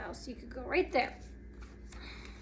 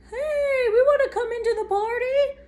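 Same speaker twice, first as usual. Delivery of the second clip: high, fast squeaky critter voice; excited animal voice; 8 semitones higher, 4 dB louder, faster than usual. Acted. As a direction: chirp it like an eager gopher. Gopher and mole arrive.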